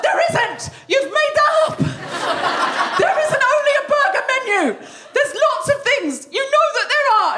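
high, loud, laughing mock outrage; hangry outburst. Wife yells at husband over burger menu, high and loud. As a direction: explode at him in disbelief. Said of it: "having screamed at Tom".

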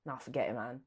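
low, quiet, easy-going dismissal; laid-back biker. Retold or imitated. The hit biker tells the driver to forget it, low and quiet. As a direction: shrug it off like the unbothered biker.

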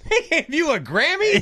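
high, squeaky incredulous question; mock disbelief. Reacting to a singer's Grammy boast, incredulous mock outrage. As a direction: shoot up high in comic disbelief. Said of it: "And I was like".

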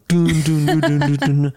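dun-dun it like a horror trailer; mock suspense soundtrack; scary movie sting. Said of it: "those scary videos when it goes".

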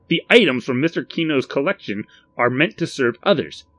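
stern reprimand. A narrator's reading. Mister Contragust reminds Barry whom items serve, stern. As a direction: lay down the rule firmly.

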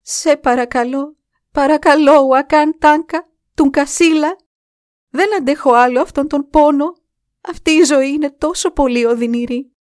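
loud, high cry of desperate prayer; anguished screamed plea. A narrator's reading. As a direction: beg the spirit for mercy at full voice. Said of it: "ούρλιαξε δυνατά".

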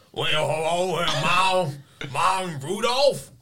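bad southern Rudolph impression. A player botches a Southern accent, overblown. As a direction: play a failed roll, botched reindeer accent. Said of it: "what you think a two would be".

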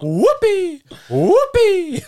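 squealed high "whoopee"; mock cheer. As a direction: fake celebratory whoop.

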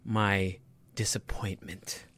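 slow hushed whisper. Pretend. Host muttering his disappointment, slow and whispered. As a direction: drop to a slow defeated whisper.

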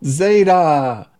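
calling out Zeta joyfully; excited greeting call. Retold or imitated. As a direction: shout it like an arriving grandkid. Grandkids calling grandpa's name.

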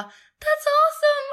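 high, loud, laughing squeal; squealed delight. Retold or imitated. Imitating the heroine's thrilled reply to free beer, squealing. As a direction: shriek it with giddy joy.

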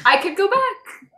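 hiccup breaking into the words; suppressing hiccups. Real, not acted. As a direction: talk through the hiccups. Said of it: "Sorry, I have hiccups".

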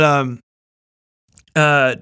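ordinary delivery

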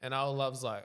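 ordinary delivery

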